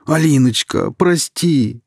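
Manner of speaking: loud, high begging plea; pleading. A narrator's reading. Kirill begging Alina to forgive him, pleading. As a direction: beg her forgiveness. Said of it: "взмолился Кирилл".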